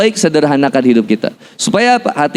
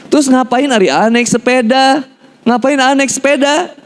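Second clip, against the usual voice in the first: high mock mother's scolding; exasperated mother; 9 semitones higher than usual. Mother questions why her son took the bicycle, exasperated. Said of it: "ditanya sama ibu saya".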